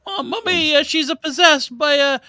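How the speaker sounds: put-on high, lighter Italian accent; mock Italian accent. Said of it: "northern English sounding fella".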